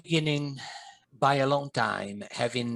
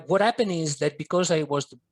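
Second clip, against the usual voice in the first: ordinary delivery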